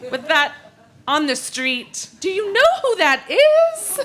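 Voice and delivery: rising to a scandalized squeal; scandalized exclaim. Acted. Emily scandalized, telling Amelia who the gentleman is.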